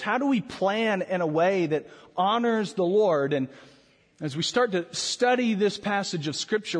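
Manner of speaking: usual speaking voice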